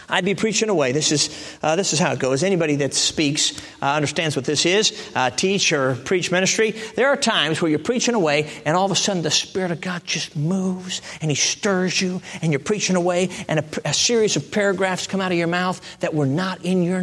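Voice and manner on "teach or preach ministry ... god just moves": normal talking voice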